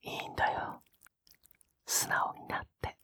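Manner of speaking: hushed coaxing. Acted. Coaxing partner to relax.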